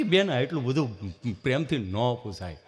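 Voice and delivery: low, halting stammerer's voice; imitated tongue-tied friend; stammering friend. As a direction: stall on a hum, then push the words out. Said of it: "જીભ જલાઈ સોટે અટકે".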